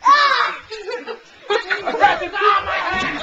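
laughing shout. Real, not acted.

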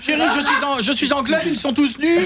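high-pitched mock girlfriend. Plays girlfriend on phone from club.

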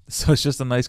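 normal talking voice

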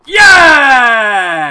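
huge, high celebratory yell; triumphant shout. Real, not acted. Gamer celebrating with Mads after scoring, triumphant shout. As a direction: roar it out, long and loud.

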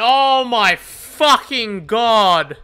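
loud, slow, drawn-out groaning curse; big, slow frustrated outburst; exasperated yell. Real, not acted. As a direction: stretch each word in loud despair. Exasperated at opponent's perk.

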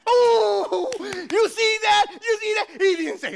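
high, crowing whoop; excited father. Acted. Neighbor boasting baby said Papa, excited high crowing. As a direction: whoop it like a thrilled new dad. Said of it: "he got all excited".

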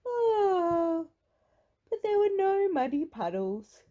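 put-on high, loud childish wail; long high wail easing into complaint; wailing disappointment. A narrator's reading. Peppa laments missing puddles.

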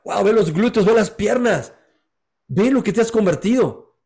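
Jesus showing the man how strong he became; amazed Jesus. Acted. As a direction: point out each muscle with growing wonder. Jesus marvels at his body.

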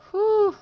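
loud high sigh of relief; relieved whew. Real, not acted. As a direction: blow out in relief. Relief after danger.

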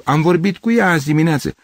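high-pitched protest; agitated, incredulous; disbelieving insistence. Acted. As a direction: insist against shocking news. Gavrilescu refuses the news.

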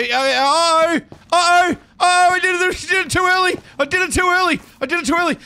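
high, loud flustered uh-oh; real alarmed panic. Real, not acted. Streamer realising a mistake, high alarm. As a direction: panic at your own mistake.

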